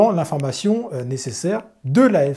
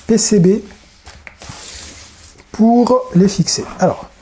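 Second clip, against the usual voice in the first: ordinary talk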